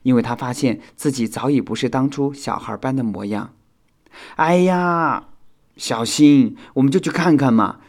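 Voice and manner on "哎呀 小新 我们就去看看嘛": high, loud wheedling; coaxing. A narrator's reading. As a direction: pester a friend to agree. Coaxing a friend to go along. (撒娇般地央求)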